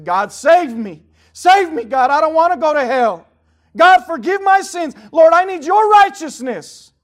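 high, loud, slow shouted prayer; voiced sinner's plea. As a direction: cry out to God for mercy. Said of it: "And you say".

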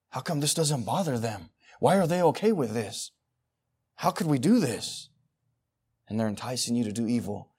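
usual speaking voice

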